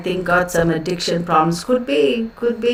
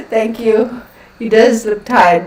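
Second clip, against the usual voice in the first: ordinary talk, nothing marked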